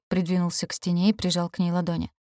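usual speaking voice

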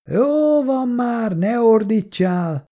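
slow, high-pitched raspy yell; rasping shout back. A narrator's reading. Alcoholic woman yelling back at the man, raspy shout. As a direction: drag out a raspy, fed-up shout. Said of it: "kiabált vissza reszelős hangon".

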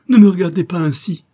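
high, loud cry of shame; ashamed woman crying out at her lover; ashamed outburst. Acted. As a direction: push him off with your voice. Pushing him away in shame.

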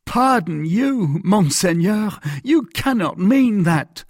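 slow, high-pitched disbelief; incredulous protest. A narrator's reading. Mercer asked to pardon the Cardinal, incredulous protest. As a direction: protest in drawn-out astonishment.

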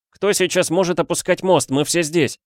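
high, baffled outcry; bewildered cry. A narrator's reading. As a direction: cry out in confusion. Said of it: "растерянно вскричал Адам Каэтан Петрович".

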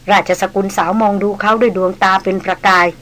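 ordinary delivery, nothing marked

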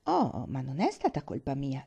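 usual speaking voice

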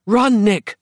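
high-pitched urgent warning; urgent yell. A narrator's reading. Roz urging her friend to run, yelling. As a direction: call out for him to flee. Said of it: "Roz yelled".

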